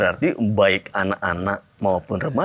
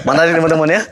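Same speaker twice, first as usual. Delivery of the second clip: laughing; 6 semitones higher, 5 dB louder, faster than usual. Real, not acted. Host laughs addressing listeners.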